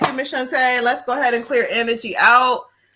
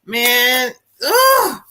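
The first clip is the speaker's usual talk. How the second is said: anguished outburst; 2 semitones higher than usual. Real, not acted. Medium overwhelmed by the spirit's anger, crying out. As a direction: let the frustration burst out as a sob.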